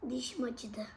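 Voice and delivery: soft whispered complaint. Real, not acted.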